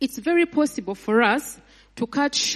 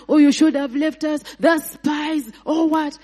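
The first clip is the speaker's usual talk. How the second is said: higher, louder complaining wail; wailing Israelites; 5 semitones higher, 4 dB louder than usual. Retold or imitated. Preacher imitating the people lamenting in the desert, higher wail. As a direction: whine like a crowd in despair. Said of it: "They are throwing themselves down".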